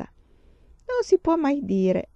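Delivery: slow, knowing, high and playful; amused. A narrator's reading. Papà teasing mamma after the show, slow and amused. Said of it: "con un guizzo di divertimento negli occhi".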